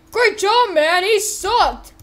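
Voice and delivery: high, loud cheer; excited congratulation. Acted. Character cheers after the shooting.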